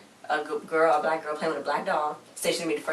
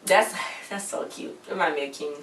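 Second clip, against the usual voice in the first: normal talking voice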